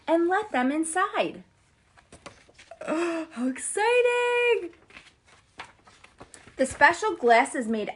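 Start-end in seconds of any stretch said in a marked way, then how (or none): 2.8-4.7 breathless, high and slow; excited gasp. Acted. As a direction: gasp with wonder. Gasp at entering the city.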